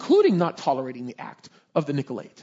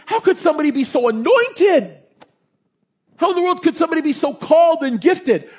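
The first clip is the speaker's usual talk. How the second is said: rising, louder preaching with higher pitch; full-force preaching; 8 semitones higher, 6 dB louder than usual. Acted. Pastor to the congregation, building preaching climax.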